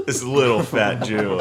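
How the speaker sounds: ordinary talk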